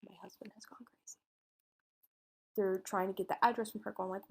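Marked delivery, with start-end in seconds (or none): 0.1-1.2 quick breathy whisper; hurried whisper. Retold or imitated. Wife keeps whispering to police, fast hushed whisper. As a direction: rush it out under your breath. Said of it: "she keeps going".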